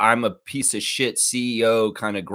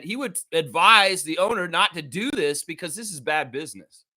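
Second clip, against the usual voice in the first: heated, ranting tirade; building raw furious rant; 8 semitones higher than usual. Real, not acted. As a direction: tear into him with real anger. Holds an angry rant.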